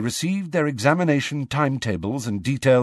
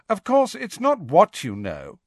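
high, showy schoolboy boast; Draco loudly; 11 semitones higher than usual. Acted. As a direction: brag loudly to your cronies. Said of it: "to tell Crabbe and Goyle loudly".

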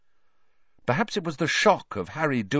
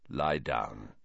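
much lower, soft and quiet; 13 semitones lower, 8 dB quieter than usual. A narrator's reading. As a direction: say it quietly, almost gently. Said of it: "he said quietly to Ron".

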